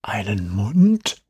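slow spooky whisper in the ear. Acted. Boy teases his friend right at his ear, slow whisper. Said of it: "Jerrys Lippen kamen ganz dicht an mein rechtes Ohr".

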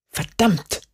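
a mild angry hiss. A narrator's reading. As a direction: spit the word out under your breath. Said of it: "zischte sie".

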